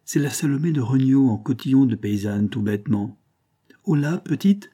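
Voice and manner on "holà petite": raised call across the field. A narrator's reading. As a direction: call out to the girl, voice lifted high. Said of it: "cria-t-il".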